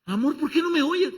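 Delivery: very high, loud pleading; a mother fed up with a deaf son; exasperated mom. Acted. Mother at son's door.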